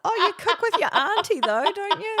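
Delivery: high, loud leading question; over-bright prompting. Retold or imitated. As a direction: prompt the child urgently in a high voice. Mum prompts son to cover for her.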